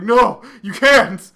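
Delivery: mock dramatic confession. Boy pleading to girl, hammed-up dramatic confession. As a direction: ham up a lovestruck declaration. Boy declares love in retelling.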